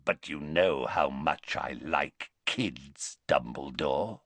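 menacing Greyback. A narrator's reading. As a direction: relish the threat, slowly.

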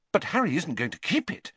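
high, prim disbelief; scoffing at a ludicrous idea. A narrator's reading. Hermione dismissing the idea to Ron, primly scoffing. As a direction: treat the idea as absurd. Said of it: "as though the idea was ludicrous".

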